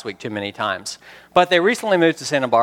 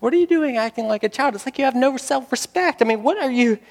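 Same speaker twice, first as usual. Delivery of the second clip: much higher-pitched, incredulous reproach; appalled scolding; 10 semitones higher than usual. Acted. Onlookers reproaching an adult acting like a child, appalled scolding. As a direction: scold as if embarrassed by the tantrum.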